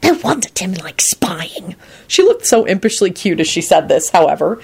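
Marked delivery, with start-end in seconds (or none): 0.0-1.8 slow, whispery aside; hissed aside. A narrator's reading. Solange to Quentin about spying, slow whispered hiss. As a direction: hiss it under the breath, drawn out. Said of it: "she hissed to Quentin".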